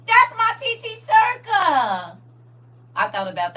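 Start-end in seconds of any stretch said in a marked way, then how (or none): 0.1-2.2 high, loud, drawn-out mock child's cry; imitating an excited grandson. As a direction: act out a kid proudly claiming an aunt. Grandson recognising her voice, retold.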